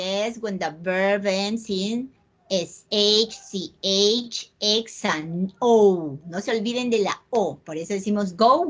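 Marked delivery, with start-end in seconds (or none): 2.5-6.2 letters drawn out for a class; slow spelled letters. Shown as a demonstration. Teacher spelling letters slowly for Spanish-speaking students. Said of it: "spelling out letters slowly".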